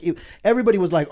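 usual speaking voice

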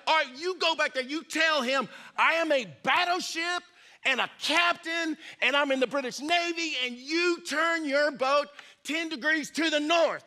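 high, emphatic indignant order; hammed-up outrage without shouting; mock furious captain. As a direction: play an exasperated captain pulling rank. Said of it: "He's furious now".

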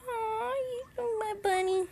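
high, slow, drawn-out coo; affectionate cooing. Real, not acted. Owner doting on her bunny, high slow cooing.

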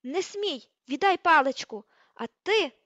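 high, very loud commands; enraged, explosive outburst; furious yelling. A narrator's reading. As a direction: shout angry orders. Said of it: "репетувала вона".

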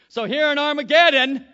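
loud, high, building toward the battle; rally the congregation; fiery pulpit build-up; preaching at full force. Acted.

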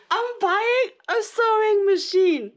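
slow, high, loud stretched announcement; drawn-out excited reveal. Real, not acted. Vlogger repeats her news slowly, thrilled and loud. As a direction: savour every word of the news.

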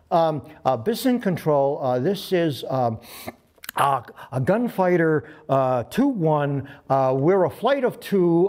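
halting, full of ums and uhs; mock tongue-tied rookie on the mic; nervous first radio call. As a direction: fumble through the call sign. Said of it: "I'd never talked on the freaking radio".

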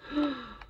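mock-horrified gasp; storyteller's audible gasp; shocked gasp. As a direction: gasp in pretend shock for children. Reader reacts to David's misdeed.

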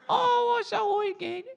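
very high, loud British squawk; mock British accent. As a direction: wail it as a cartoon Brit. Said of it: "I'll do a flawless British accent".

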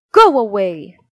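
loud shout to drive her off; angry shout. Acted. Soldiers sending the old woman off, angry shout. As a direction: yell at the beggar to leave. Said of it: "they shouted".

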